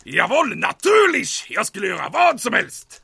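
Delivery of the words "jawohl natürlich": eager shout. Acted. Commandant eagerly agreeing.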